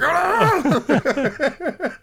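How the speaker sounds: sudden high-pitched yelp. Retold or imitated. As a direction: yelp in alarm. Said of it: "I was like".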